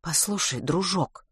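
quiet, restrained warning; stern. A narrator's reading. Mother on phone putting a pestering boy in place, stern. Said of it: "строго сказала трубка".

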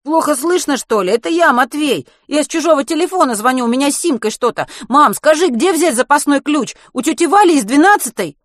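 loud, high-pitched insistent appeal; indignant urgency. A narrator's reading. Boy calling mother from a borrowed phone, insistent. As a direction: press her for answers, agitated boy.

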